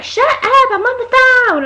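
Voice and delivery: high, loud imitation of a shouted complaint; mock angry yell. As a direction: yell it like an irritated man on the phone. Retold angry snap at her.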